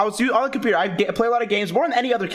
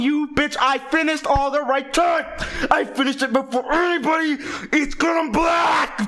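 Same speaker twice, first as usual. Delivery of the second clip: higher, shouted fury, slowing at the end; furious shout; 6 semitones higher, slower than usual. Retold or imitated. Furious kid yelling at the teacher, higher and slower. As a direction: yell it out in a childish rage.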